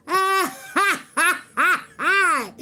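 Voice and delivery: high, loud jeering cackle; mocking laugh. Real, not acted. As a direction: cackle at the haters. Laughing at the haters.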